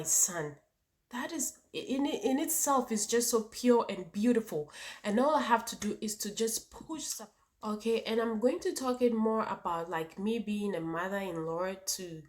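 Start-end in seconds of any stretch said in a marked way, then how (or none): none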